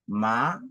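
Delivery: "ma" starting low and rising; second tone. Shown as a demonstration. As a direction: lift "ma" steadily like a question. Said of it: "le deuxième ton".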